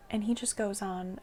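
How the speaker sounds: ordinary delivery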